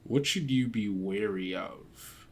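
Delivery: usual speaking voice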